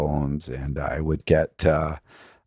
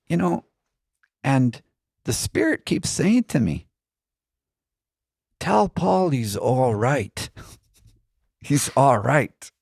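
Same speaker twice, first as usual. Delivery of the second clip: mock Irish accent; 9 semitones higher than usual. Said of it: "I'm not doing a very good, uh, Irish accent".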